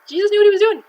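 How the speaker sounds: loud, high burst of triumph; excited exclamation. Real, not acted. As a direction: belt it out like a big reveal. Triumphant punchline of the miracle.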